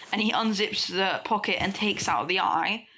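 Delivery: ordinary delivery, nothing marked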